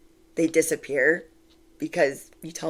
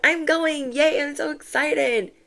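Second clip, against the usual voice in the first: higher, mock-giddy cheer; giddy Cinderella; 10 semitones higher than usual. Cinderella celebrating her trip to the ball, giddy and higher. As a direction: squeal it like a thrilled girl off to the ball. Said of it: "even Cinderella was like".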